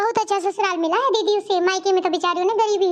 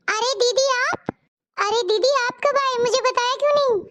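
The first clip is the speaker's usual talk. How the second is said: pleasant surprise; 4 semitones higher than usual. Acted. Sister-in-law greets an unannounced visitor, surprised and higher. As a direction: act surprised at an unexpected visitor.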